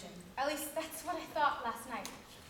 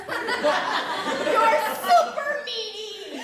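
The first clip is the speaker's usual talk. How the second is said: slow, loud, higher, laughing it out; laughing mock line; 5 semitones higher, 9 dB louder, much slower than usual. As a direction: stretch it through giggles. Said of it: "he says".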